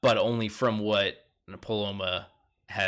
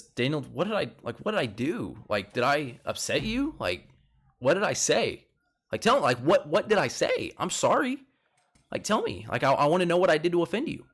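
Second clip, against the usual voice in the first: higher-pitched, anxious questioning; imitated pleading; 5 semitones higher than usual. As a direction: plead to learn what you did wrong. Acted pleading after man storming off.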